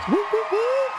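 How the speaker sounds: high, whooping cheer; excited whoops. Real, not acted. Vlogger hyping her favorite Boston movie, whooping.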